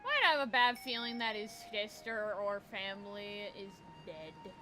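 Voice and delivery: usual speaking voice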